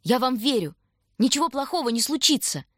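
high, encouraging call back; shouted reassurance. A narrator's reading. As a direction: call out comfort through a forced smile. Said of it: "крикнул он, пытаясь улыбнуться".

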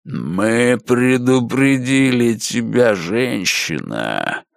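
evil rumbling voice. A narrator's reading. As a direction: rumble the warning darkly. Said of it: "пророкотал злобный голос".

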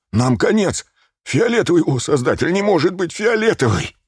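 высокие панические восклицания; выкрикни в ужасе, высоким голосом; панические крики. A narrator's reading. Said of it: "восклицаний и криков обрушился". (панический крик)

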